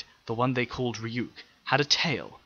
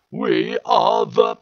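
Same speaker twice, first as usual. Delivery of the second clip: higher, louder, slow harsh robotic Dalek voice; 10 semitones higher, 10 dB louder, much slower than usual. Acted. Alien machine questioning the planet's people, slow harsh robot voice. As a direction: grind out each word like a machine.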